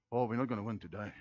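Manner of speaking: low, soft, acted defeatism; mock defeatist. Player sizing up the opposing team, mock defeated. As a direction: play a half-hearted fighter who has given up. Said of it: "what he doesn't sound like".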